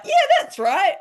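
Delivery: high and loud, cheering herself on; a big triumphant yes to yourself; triumphant self-agreement. Retold or imitated. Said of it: "And then I'm like".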